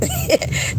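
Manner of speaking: quick airy high laugh; high breathy giggle. Real, not acted. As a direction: let a soft squeaky laugh out. Laughing after honesty.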